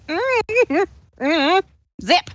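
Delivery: hummed high shush then zip; mock shushing hum. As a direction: zip the lips with a playful hum. Mimes zipping Alora's lips.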